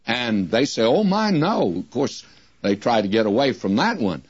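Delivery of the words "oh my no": high-pitched imitation of polite denial; mock protest. Retold listeners' polite protest.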